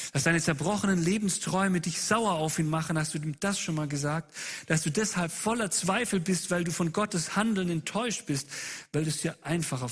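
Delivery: impassioned preaching, insistent questions piled up; eindringliche Predigt. Real, not acted. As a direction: press the congregation hard, line after line. Preacher presses congregation with questions. (eindringlich und leidenschaftlich gepredigt)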